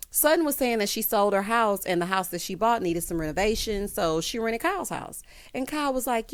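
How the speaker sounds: normal talking voice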